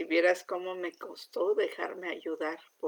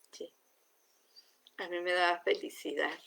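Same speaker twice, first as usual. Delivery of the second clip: ordinary talk, nothing marked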